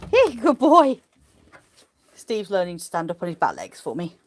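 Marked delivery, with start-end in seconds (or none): 0.1-1.0 high, louder praise. Real, not acted. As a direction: reward him happily. Praising the sheep.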